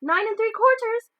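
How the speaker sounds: put-on high, piping little-girl voice; little girl chiming in high and loud; small girl piping. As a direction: pipe it up like a small eager girl. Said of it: "piped a small girl".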